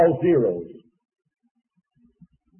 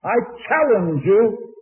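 rising preaching challenge; 7 semitones higher, 5 dB louder than usual. Real, not acted. Preacher challenging his congregation.

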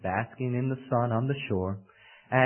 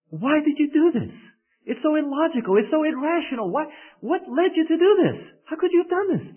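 panicked crocodile; 14 semitones higher than usual. Acted. The sinking crocodile pleads with the scorpion, high and panicked. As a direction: cry out in disbelief as you sink.

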